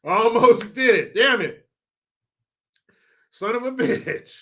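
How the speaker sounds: annoyed he cracked up; frustrated. Real, not acted. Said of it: "I almost said it with a straight face".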